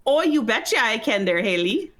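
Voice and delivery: folksy, exaggerated Minnesotan lilt; Minnesotan accent. Shown as a demonstration. Guest obliging host's request, in a Minnesotan accent. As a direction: put on a thick Minnesotan accent. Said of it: "talk to me in a Minnesotan accent".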